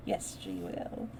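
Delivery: plain reading voice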